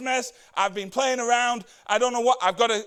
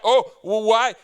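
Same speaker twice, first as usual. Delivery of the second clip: louder, put-on hurt "why?"; mock offended; 6 dB louder than usual. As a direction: act wounded for show. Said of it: "he puts on this front".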